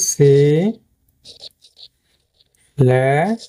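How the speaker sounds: "সি-", long pause, "লে-", each drawn out; syllable-by-syllable breakdown. Shown as a demonstration. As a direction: stretch "সি-" and "লে-" slowly with rising ends. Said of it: "এটা যদি আমি ভাঙ্গি".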